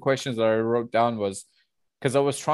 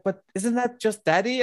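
higher, teasing mock question; quoting a coworker; 10 semitones higher than usual. Coworker catching her out, teasing high question. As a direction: pin her down with a knowing question. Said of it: "they was like".

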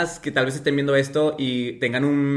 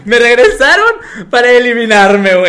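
laughing indignation; 9 semitones higher, 14 dB louder than usual. Real, not acted. Retelling being eliminated again.

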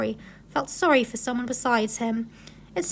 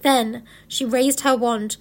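plain reading voice

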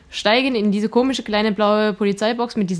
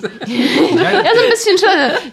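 laughing; 10 semitones higher, 5 dB louder, much slower than usual. Real, not acted. Laughing while answering.

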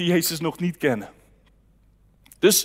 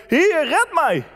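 cry for help; 7 semitones higher, slower than usual. Acted. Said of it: "riep hij".

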